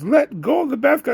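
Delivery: high, loud snapping mother; shrill mom voice. Retold or imitated. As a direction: imitate an irritated mother. Parent yelling, retold.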